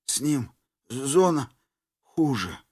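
fading dying whisper; faint deathbed whisper. A narrator's reading. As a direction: whisper it, almost gone. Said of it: "прошептал умирающий ресторатор".

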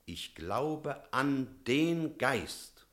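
slow, weighty words of faith; slow solemn declaration. Acted. The priest answering the doubter, slow and solemn. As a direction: lay each word down like a creed.

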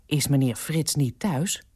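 ordinary delivery

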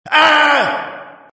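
high, loud monster shriek; sudden high-pitched howl; monstrous scream. A narrator's reading. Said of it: "раздался чудовищный вопль". (чудовищный вопль)

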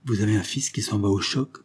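usual speaking voice

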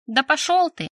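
a quick burst of indignation; indignant outburst. A narrator's reading. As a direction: blurt it out in outrage. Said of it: "выпалила возмущённо".

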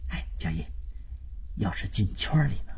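secretive whisper. A narrator's reading. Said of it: "胜利诡秘的一笑".

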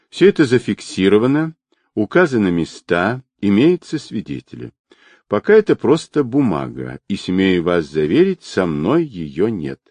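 usual speaking voice

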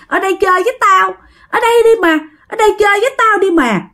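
put-on high, fast, coaxing ghost child; ghost girl voice. Ghost girl begging the child to stay, high coaxing. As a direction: lure them in, eerily. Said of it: "bạn vẫn nghe tiếng cười của nó".

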